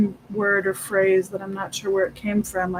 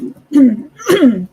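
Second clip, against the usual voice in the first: throat clearing; 6 semitones higher, 10 dB louder than usual. Real, not acted.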